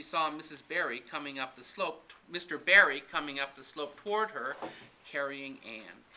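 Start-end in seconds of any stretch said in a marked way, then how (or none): none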